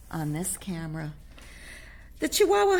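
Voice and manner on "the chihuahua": "Chihuahua" much higher and louder; excited. Acted. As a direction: announce "Chihuahua" with glee. Reader excites the reveal.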